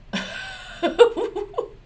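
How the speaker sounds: high breathy scoffing laugh; a derisive burst of laughter; mocking laugh. Real, not acted. Laughing at Patel partying.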